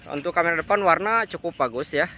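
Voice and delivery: recorded on a phone mic outdoors; phone mic recording. Real, not acted. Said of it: "suara langsung dari mikrofon H P-nya".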